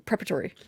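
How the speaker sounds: "preparatory" shortened to prep-a-tory; skipped letters. Shown as a demonstration. Explaining skipped letters to co-host, shortened pronunciation. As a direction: drop a middle syllable from "preparatory". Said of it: "we're skipping over wor-, letters".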